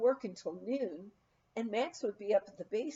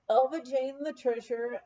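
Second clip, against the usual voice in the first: usual speaking voice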